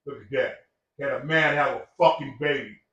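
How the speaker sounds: normal talking voice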